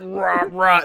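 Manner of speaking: loud mock snarling "rah, rah"; imitated roar. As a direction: bark out two mock roars. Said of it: "he goes like".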